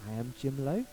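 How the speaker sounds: low, tentative questioning lilt; subtle, careful correction in a low voice; trying to be nice. Retold or imitated. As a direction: say it gently, rising at the end. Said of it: "trying to nice way go".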